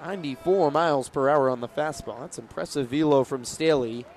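ordinary delivery

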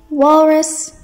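usual speaking voice

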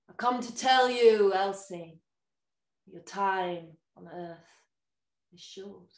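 slow, booming, then fading; ominous devil voice. Acted. The devil tells Elsie her time is short, ominous. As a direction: pronounce her fate like a dark visitor.

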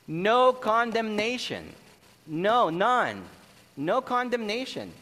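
NO CONDEMNATION high and loud, repeated emphatically; powerful declaration. Shown as a demonstration. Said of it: "It's a lot powerful than saying".

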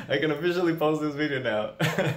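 giddy laughing after the good news; laugh, light and giddy with relief; relieved laughter. Real, not acted. After learning she passed.

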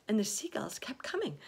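usual speaking voice

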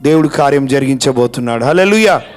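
ordinary talk, nothing marked

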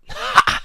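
loud, breath-heavy "Ha!"; sudden shouted laugh. A narrator's reading. Inner voice breaking into her mind, sudden loud laugh. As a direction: burst out with one explosive cackle. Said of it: "schrie plötzlich eine Stimme".

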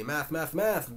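wild, rising repeated cry; wildly. A narrator's reading.

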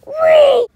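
a loud, high, joyful whee as he jumps; gleeful whee. Acted. Toy koopa leaping off the fireplace, gleeful squeal. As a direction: squeal with delight mid-leap.

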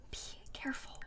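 barely audible whisper; whispered warning. Real, not acted. Streamer cautioning her Sim by the pond, whispering. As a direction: repeat the warning under your breath, fully whispered. Repeats warning under breath.

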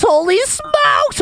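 high, slow astonished exclaim; stunned and delighted; amazed. Real, not acted. Amazed at new slides.

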